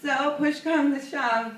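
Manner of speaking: ordinary delivery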